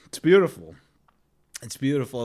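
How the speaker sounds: ordinary talk, nothing marked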